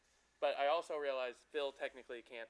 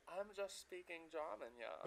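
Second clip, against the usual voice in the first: higher, softer put-on German accent; 3 semitones higher, 7 dB quieter than usual. Shown as a demonstration. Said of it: "Let me hear your German".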